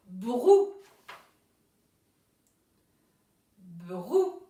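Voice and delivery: "Brou" loud and slowly sounded out, rising at the end; dictated syllable. Shown as a demonstration. Teacher dictates the first syllable to pupils, loud and drawn out. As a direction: stretch "Brou" so both consonants and the ou are heard. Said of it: "La première syllabe".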